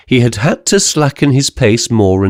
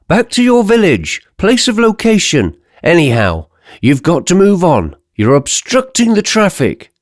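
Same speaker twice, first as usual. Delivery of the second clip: inspector; 7 semitones higher than usual. Acted. Police inspector orders giant away.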